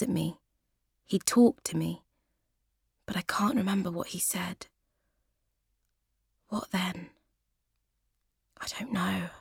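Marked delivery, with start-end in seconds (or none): 3.1-9.3 hushed, fearful whisper; frightened whisper. Acted. Panicking woman whispering to herself, frightened. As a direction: breathe the words out in fear.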